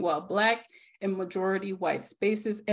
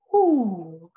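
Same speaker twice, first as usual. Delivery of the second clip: a loud, teary exhale of relief; tearful whew; 8 dB louder than usual. Real, not acted. Host overwhelmed on her hundredth episode, tearful loud exhale. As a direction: breathe out hard through the tears.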